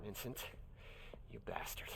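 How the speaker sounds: low, soft curse under the breath; muttered curse. Acted. Alone with the bottle, cursing Vincent under the breath. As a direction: grumble it to yourself, half-amused.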